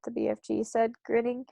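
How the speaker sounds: usual speaking voice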